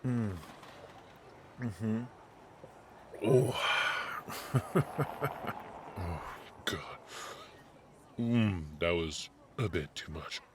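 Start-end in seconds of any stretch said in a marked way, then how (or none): 3.2-5.9 evil laugh. Acted. Mock-evil prank payback laugh.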